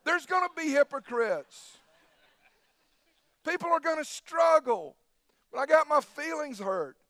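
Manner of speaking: high, loud pulpit preaching; preaching at full force. Acted. Preacher rousing his congregation, preaching at full force. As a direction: drive each line to the congregation.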